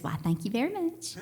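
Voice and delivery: sweet Southern thank-you like [name]. Retold or imitated. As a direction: mom imitated with a light Southern accent. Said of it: "just like [name]".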